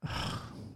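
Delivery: soft breath out after the thrill; acted happy exhale; contented sigh. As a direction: sigh with satisfaction. After a wonderful flight.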